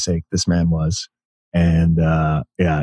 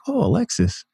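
Mike Tyson impression; 10 semitones higher than usual. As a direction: pitch way up like a famous heavyweight with a high voice. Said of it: "that's my terrible Mike Tyson impression".

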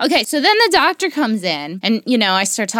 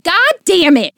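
exasperated outburst; 8 semitones higher, 5 dB louder than usual. Retold or imitated. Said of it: "And I was like".

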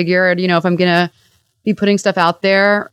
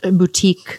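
put-on boutique with a playful French accent, stress on the last syllable; slower than usual. Guest jokingly says boutique in a French accent. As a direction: say boutique like a Parisian, a light touch. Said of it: "all that's missing was the accent".

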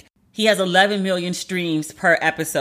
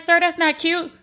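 unimpressed retort; 9 semitones higher than usual. Retold or imitated. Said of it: "I'm like".

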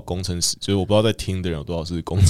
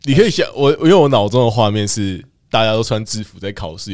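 ordinary talk

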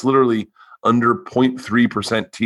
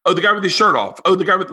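mock fan recognizing; 6 semitones higher, faster than usual. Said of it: "it was like".

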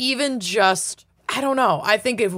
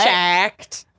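broad Minnesotan vowel in shocked; hammy upper Midwest accent. Pretend. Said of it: "their worst Minnesotan accents".

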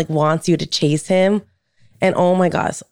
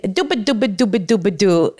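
put-on baby babble; 3 semitones higher than usual. Mother imitating her babbling son to a friend, light baby babble. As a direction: mimic a baby's nonstop babble, playfully. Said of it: "He's like".

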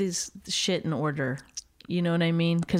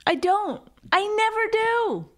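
mock innocent; 9 semitones higher than usual. Mock humble protest.

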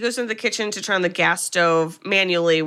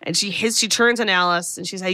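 ordinary talk

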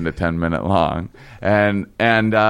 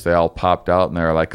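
ordinary delivery

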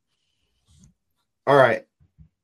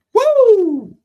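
whoop; 12 dB louder than usual. Real, not acted. Cheering alone.

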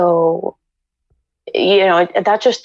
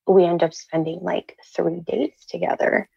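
hushed whisper so parents won't hear; whispering on the phone; 5 dB quieter than usual. Real, not acted. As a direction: whisper it quietly. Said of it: "Why are you whispering?".

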